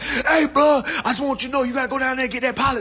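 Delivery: high, loud, fast mock coworker voice; forklift driver. Coworker with bad breath leans in, high and fast. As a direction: rattle it off like a coworker leaning in close.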